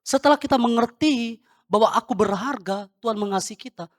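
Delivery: loud, urgent sermon delivery; emphatic preaching. Real, not acted. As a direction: drive the teaching home to the congregation. Berkhotbah menegaskan ajaran. (berkhotbah lantang dan tegas)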